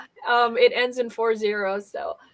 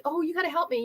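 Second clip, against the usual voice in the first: higher, softer begging; retold plea; 6 semitones higher, 7 dB quieter, faster than usual. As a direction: imitate a caller pleading for help. Retelling a desperate caller's plea.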